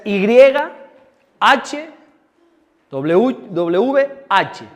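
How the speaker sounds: slow letter-by-letter spelling; deletreo lento. Shown as a demonstration. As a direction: spell it out slowly for note-taking. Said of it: "tomen nota". (deletreando despacio)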